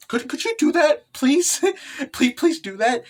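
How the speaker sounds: high, playful begging; pleading. Acted. Character begging for a favor.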